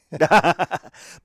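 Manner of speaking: big burst of laughter; loud hearty laugh. Real, not acted. Laughing at Australia joke.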